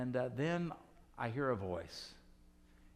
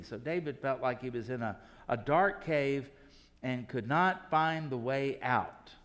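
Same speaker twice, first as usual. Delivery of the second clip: normal talking voice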